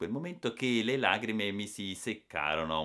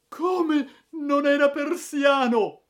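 very high, loud, slow scream; outraged scream; 11 dB louder, much slower than usual. Acted. Matilde screaming about her Persian carpet, outraged shriek. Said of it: "urlò in quel momento la sora".

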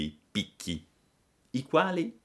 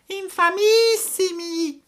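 very high, slow cat voice; cat's mock outrage; 9 dB louder, slower than usual. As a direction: outdo the fox with a shrieked echo. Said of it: "ripeté il gatto". (il gatto rincara, voce acutissima)

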